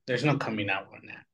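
ordinary delivery

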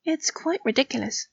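hushed, comforting agreement; soothing. A narrator's reading. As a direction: console gently, high and soft. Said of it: "patting his head soothingly".

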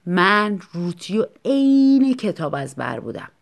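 ordinary delivery, nothing marked

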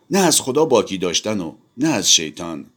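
usual speaking voice

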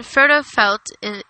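plain reading voice

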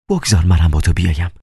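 plain reading voice

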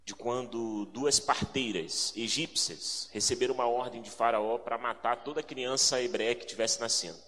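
usual speaking voice

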